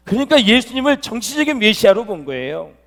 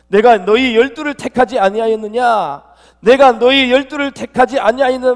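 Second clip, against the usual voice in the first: loud, raised voice hammering home 열두를 택하지; forceful emphasis; 5 semitones higher, 8 dB louder than usual. Retold or imitated. Preacher quotes Jesus stressing his choice of the twelve, forceful emphasis. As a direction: repeat the question with high, loud insistence. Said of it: "막 강조를 했어요".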